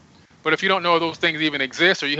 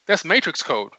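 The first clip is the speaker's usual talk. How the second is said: ordinary talk, nothing marked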